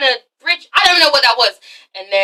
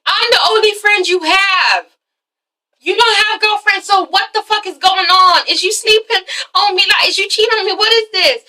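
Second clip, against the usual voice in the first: high-pitched, indignant interrogation; jealous accusation; 6 semitones higher than usual. Acted. Girlfriend accusing Derek of cheating, indignant and high. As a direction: fire off acted accusations in a raised pitch.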